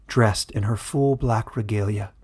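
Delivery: ordinary delivery, nothing marked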